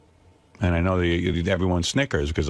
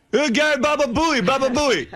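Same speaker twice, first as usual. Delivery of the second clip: put-on high, loud, delighted Italian accent; hammed-up Italian salesman shouting a name he knows; 4 dB louder than usual. Salesman recognizing the stylist's name, loud Italian accent. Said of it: "he had some foreign accent".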